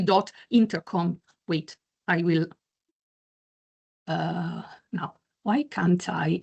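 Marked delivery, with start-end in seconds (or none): 1.5-6.4 whispered aside. Real, not acted. Fumbling with computer, muttering aside.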